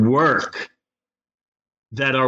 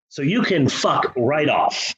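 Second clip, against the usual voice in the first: ordinary delivery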